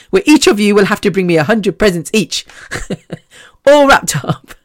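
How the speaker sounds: joking demand broken by giggles; amused, chuckling between phrases; laughing. Real, not acted. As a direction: laugh through a playful order. Laughing at own birthday demand.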